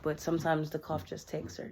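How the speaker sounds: usual speaking voice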